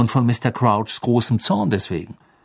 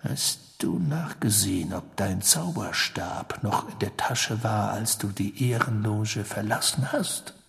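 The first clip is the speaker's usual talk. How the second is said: hushed, slow, near-whispered question; Sirius, intent; 9 dB quieter than usual. Acted. Sirius turns to question Harry, hushed and intent. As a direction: question closely, almost whispering.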